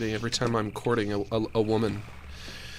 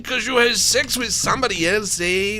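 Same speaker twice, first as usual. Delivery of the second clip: put-on high, loud Chicano-accented wailing rant; Cheech Marin impression; 12 semitones higher, 8 dB louder than usual. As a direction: play Cheech Marin in a hammed-up Chicano accent, pleading with the cheating wife. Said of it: "my Cheech Marin impression".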